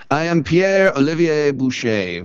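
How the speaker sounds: a lightly put-on French charmer saying his name; suave French introduction. A teen at a dance introduces himself to impress, mild French accent. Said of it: "at the dance... What's your name".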